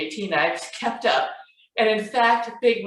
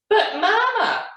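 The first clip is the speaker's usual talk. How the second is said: high, drawn-out cub's protest; whiny Baby Bear; 13 semitones higher, 4 dB louder than usual. A narrator's reading. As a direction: plead like a little cub, high and stretched.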